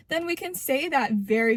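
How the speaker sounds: ordinary talk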